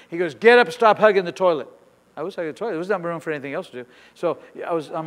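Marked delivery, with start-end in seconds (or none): 0.3-1.7 loud, brisk command; retold scolding order. God scolds the kneeling preacher, retold scolding order. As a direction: order him up off the floor.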